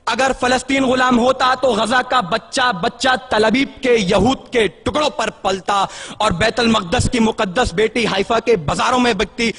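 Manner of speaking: ordinary talk, nothing marked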